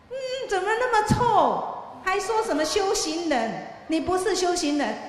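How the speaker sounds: acted disgust, voice jumping high as he scorns the eye; scornful young man. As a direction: spit out the contempt like a spoiled youth.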